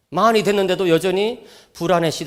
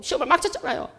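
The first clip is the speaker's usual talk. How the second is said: higher whiny voice of a crying teenager, mock wail; imitating crying son; 10 semitones higher, 8 dB quieter than usual. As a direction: mimic the son sobbing out 시험을 망쳤잖아요. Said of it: "막 엉엉 우는". (우는 아들 흉내, 높고 징징대는 목소리)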